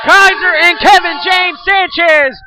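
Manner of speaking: slow, drawn-out champion announcement; ring announcer. Acted. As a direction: announce like ringside. Ring announcer style intro.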